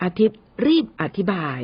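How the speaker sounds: plain reading voice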